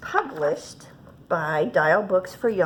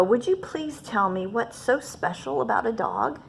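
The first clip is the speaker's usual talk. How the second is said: usual speaking voice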